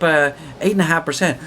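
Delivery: ordinary delivery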